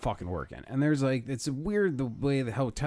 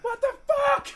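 squealed, much higher mock yell; hammed-up distant cry; background shout; 5 dB louder than usual. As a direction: shriek it from far off. Chaos heard in background.

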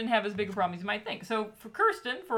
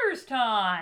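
announcer voice; 4 dB louder, slower than usual. Acted. Segment announcement on the podcast.

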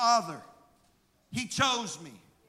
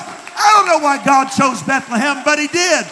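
louder, shouted declaration of God's choice; full-force preaching; 4 semitones higher, 13 dB louder than usual. Acted. Pastor proclaims God's favor on the church, shouted preaching. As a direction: proclaim it like a promise.

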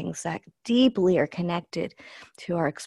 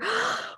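sharp gasp; 4 dB quieter than usual. Shown as a demonstration. Demonstrating holding breath on curve.

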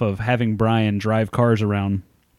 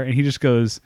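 ordinary talk

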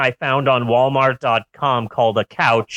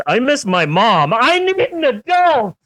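put-on high, whiny child asking for mom; lost little kid voice; 10 semitones higher than usual. Lost child in pickup line.

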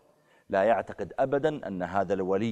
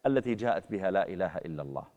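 ordinary delivery

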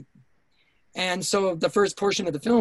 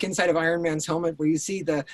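normal talking voice

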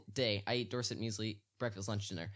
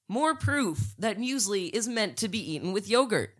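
worked-up rant; 7 semitones higher, 3 dB louder than usual. Real, not acted. Co-host ranting to Joseph that muesli goes with yogurt, worked up and high. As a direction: pitch up high, ranting in disbelief.

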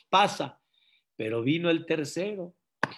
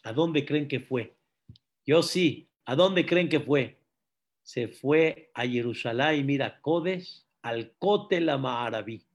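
usual speaking voice

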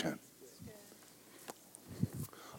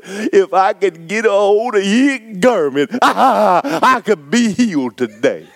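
full-force preaching; 8 semitones higher, slower than usual. Real, not acted.

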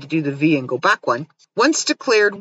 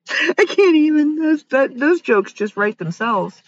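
high, laughing through the words; real giggling disbelief; 9 semitones higher than usual. Real, not acted. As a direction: gasp it out mid-laugh. Cracking up at grooms of stool.

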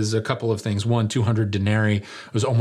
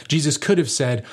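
usual speaking voice

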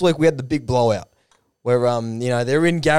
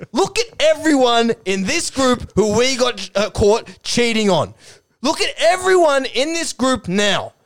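slow, high-pitched accusing speech; mock confrontation; 6 semitones higher than usual. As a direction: call them all out slowly, pitched up. Acted confrontation of the group.

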